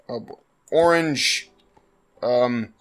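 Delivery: ordinary talk, nothing marked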